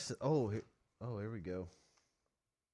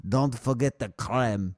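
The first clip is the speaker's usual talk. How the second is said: put-on French accent; 5 dB louder, slower than usual. Mocking French diners.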